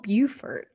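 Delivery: Beaufort said as BYOO-furt. Shown as a demonstration. Questioning the town's name, Beaufort as BYOO-furt. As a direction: start Beaufort like "beautiful". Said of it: "B E A U says beautiful".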